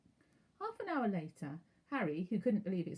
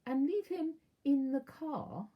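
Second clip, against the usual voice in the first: slow, higher aunt voice; slowly; 8 semitones higher, 4 dB louder, slower than usual. A narrator's reading. Aunt finishing her plan, slow and higher. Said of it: "said Aunt Petunia slowly".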